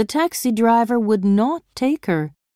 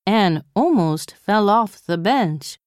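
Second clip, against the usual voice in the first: plain reading voice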